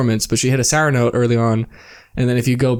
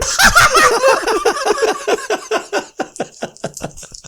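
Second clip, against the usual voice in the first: a long, helpless laugh way up high; long high laughing fit. Real, not acted. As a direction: crack up for several seconds.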